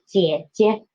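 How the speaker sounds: teacher's model 姐姐, light second 姐 lifted. Shown as a demonstration. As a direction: dip on first 姐, step up on the second. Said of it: "so it's higher".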